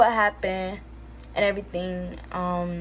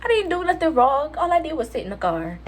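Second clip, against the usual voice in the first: indignant protest; 5 semitones higher than usual. Retold or imitated. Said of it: "I was like".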